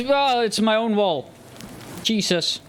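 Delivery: ordinary talk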